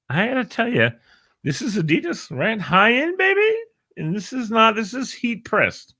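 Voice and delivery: high, hammed-up showoff voice; mock bragging. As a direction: show off the jersey in a high mock-proud voice. Bragging about Adidas jersey.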